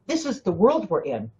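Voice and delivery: normal talking voice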